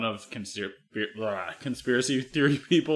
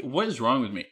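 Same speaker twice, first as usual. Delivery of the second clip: ordinary talk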